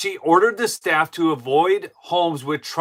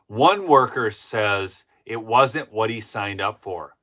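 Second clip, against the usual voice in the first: ordinary talk, nothing marked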